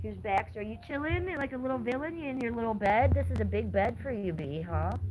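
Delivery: soft cooing to a pet. Real, not acted. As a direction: coo gently at the dog. Cooing to her old dog.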